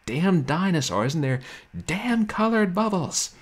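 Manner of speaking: loud, high, hammed-up exasperation; mock screaming. Gamer rages at a puzzle game, mock screaming. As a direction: yell it like an enraged gamer, playfully. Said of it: "Until you start screaming at those".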